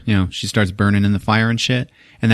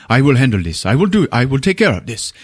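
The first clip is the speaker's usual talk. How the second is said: retold Javier; 3 semitones higher than usual. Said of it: "and he's like".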